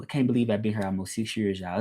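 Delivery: ordinary delivery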